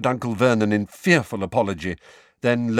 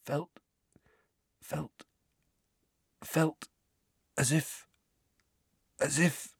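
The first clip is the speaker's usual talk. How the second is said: high, faint, halting voice; trembling; 8 semitones higher, 6 dB quieter than usual. A narrator's reading. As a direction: falter softly, groping for words. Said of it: "still trembling".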